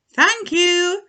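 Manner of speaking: bright high squeaky thanks; high grateful dog. A narrator's reading.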